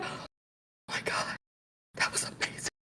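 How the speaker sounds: very soft, slow, voiceless gasp; awed whisper. Retold or imitated. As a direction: whisper it, overwhelmed with awe. Acting out awed reaction after episode.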